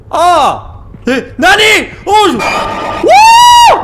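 very high drawn-out scream; terrified shriek. Real, not acted. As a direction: shriek at the jump scare. Something jumps out. (hurlement de terreur)

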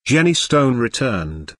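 ordinary delivery, nothing marked

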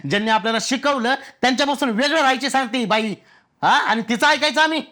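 irritated rant climbing in pitch; worked-up indignation. Acted. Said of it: "एवढं चिडू नका".

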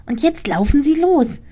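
high, pressing little rat; urgent rat voice. Acted. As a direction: hurry her along in a squeak. Rat urges her to run.

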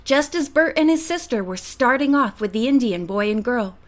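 ordinary delivery, nothing marked

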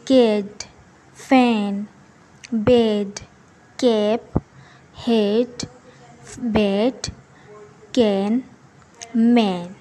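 teacher reading example words one by one; word list demo. Shown as a demonstration. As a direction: read each example word slowly like a teacher. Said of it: "इसका sound निकलेगा ए करके".

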